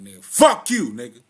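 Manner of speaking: loud retold curse. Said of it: "Like, I heard, like".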